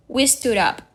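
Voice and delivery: ordinary delivery, nothing marked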